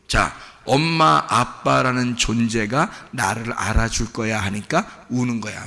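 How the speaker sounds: normal talking voice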